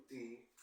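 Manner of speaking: "ti" said softly and weak; unstressed syllable. Shown as a demonstration. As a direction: drop the voice on "ti". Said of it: "el acento prosódico se encuentra en la segunda". ("ti" suave, sílaba átona)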